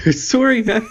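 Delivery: high, put-on Canadian apology; mock Canadian cannibal apologizing. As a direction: do a silly Canadian accent. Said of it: "I don't know why I'm doing a voice".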